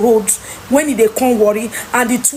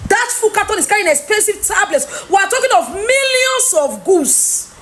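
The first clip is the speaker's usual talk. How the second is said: angry shout; 6 semitones higher than usual. Real, not acted. Angry rant over the lost tablets.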